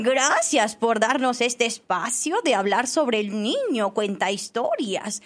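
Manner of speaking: put-on gushing children's-theatre rock addressing the audience; hammed-up elderly rock, pitch leaping on key words; old talking rock. Shown as a demonstration. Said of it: "voy a proceder a hacer la voz de".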